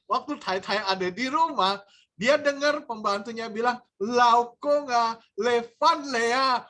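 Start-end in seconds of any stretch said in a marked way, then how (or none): 4.0-6.6 slow, drawn-out greeting. Retold or imitated. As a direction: stretch "Laokong ah, Levan Lea" slowly. Madam overhears, voice slow and changed. (sapaan "Laokong ah, Levan Lea" diucapkan lambat dan dibuat-buat)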